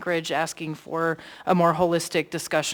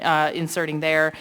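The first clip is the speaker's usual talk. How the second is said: ordinary talk, nothing marked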